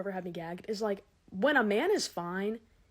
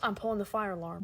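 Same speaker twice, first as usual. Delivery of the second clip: ordinary talk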